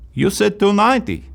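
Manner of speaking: put-on high indignant protest, lightly accented; indignant customer. Customer protesting price rise.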